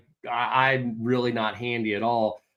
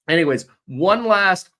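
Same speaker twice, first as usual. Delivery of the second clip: ordinary talk, nothing marked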